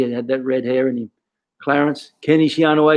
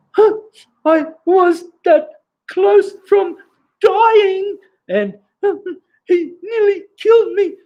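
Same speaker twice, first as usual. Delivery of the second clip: put-on very high, slow, bawling child; hammed-up tearful wail, gasping between words; mock sobbing; 4 dB louder, slower than usual. Kid sobs to his coach about nearly dying, hammed-up crying. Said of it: "crying like".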